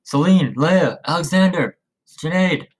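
calling out each name loud and high; shouting names. Acted. Father calling for his missing family, loud shout. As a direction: shout the names out across the woods. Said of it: "Marcus began to shout".

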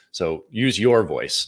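slow, with weight on "your"; stress on your. Shown as a demonstration. Coach repeating her advice to listeners, "your" stressed. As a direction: lean into "your" again, unhurried. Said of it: "Why do I emphasize the word "your".

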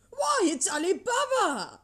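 high, loud cry of delight; ecstatic exclamation. A narrator's reading. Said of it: "Scrooge exclaimed in ecstasy".

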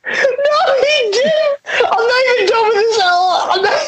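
high, wheezy words through laughter; helpless laughter. Real, not acted. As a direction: try to talk while cracking up. Can't stop laughing at the tweet.

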